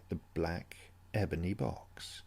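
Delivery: usual speaking voice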